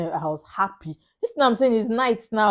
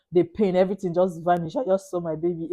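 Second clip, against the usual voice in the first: ordinary talk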